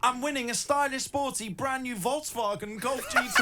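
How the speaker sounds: high, soft, hammed-up King Julian voice on Volkswagen; giggling through a King Julian accent. Shown as a demonstration. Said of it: "doing it like King Julian".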